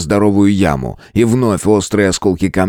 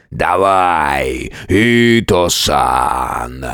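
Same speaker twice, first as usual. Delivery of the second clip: low, drawn-out monster growl; monster roar; 6 semitones lower, much slower than usual. A narrator's reading. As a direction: roar it slowly and deeply. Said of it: "Рык монстра".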